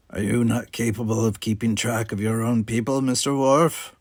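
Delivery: a lightly voiced sneering Klingon; Gower's challenge. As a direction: needle him about his crew.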